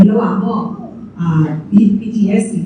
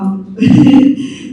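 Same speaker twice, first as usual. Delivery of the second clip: real chuckle, pitched up; short run of genuine, higher laughter; laughing; 5 semitones higher than usual. Real, not acted. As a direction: laugh it off after speaking. Amused repeating the remark.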